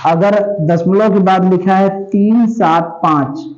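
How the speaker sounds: usual speaking voice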